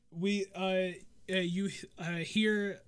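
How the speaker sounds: ordinary delivery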